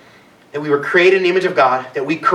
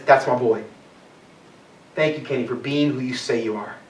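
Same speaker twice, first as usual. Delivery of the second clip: soft, low and fatherly; quiet proud father's blessing; tender God voice; 6 semitones lower, 7 dB quieter than usual. Acted. God's voice, tender quote.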